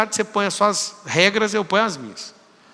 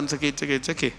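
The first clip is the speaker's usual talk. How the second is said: mocking mumble; 4 semitones lower, 7 dB quieter, much faster than usual. Mocking stock excuse.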